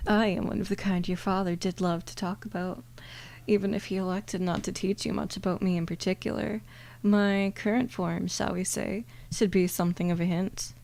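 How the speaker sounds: normal talking voice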